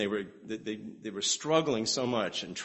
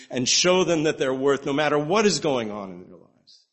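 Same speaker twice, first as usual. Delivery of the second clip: raised, fervent preaching voice; 4 semitones higher, 5 dB louder than usual. Acted. Preacher applying the story to struggling kids, fervent preaching. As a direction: drive the point home to the pews.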